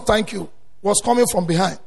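ordinary talk

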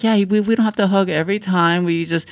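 woman telling him hugs aren't needed, pitched high; high female voice. Retold or imitated. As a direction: lift it way up like her. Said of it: "she's like".

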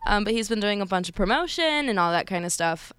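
ordinary talk, nothing marked

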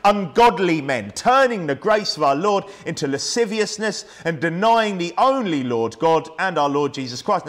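solemn preacher reading the Bible aloud; scripture reading. Acted. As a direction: read the verse with weight.

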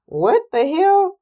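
high, slow drawn-out disbelief; stunned outcry. Retold or imitated. Seeing the chicken survive, high slow disbelief. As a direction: stretch it out, pitch up in shock. Shocked at the video.

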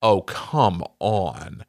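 retold weary protest, low and drawn out; exasperated. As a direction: drag out each word in disbelief. Retold exasperated reply to wife.

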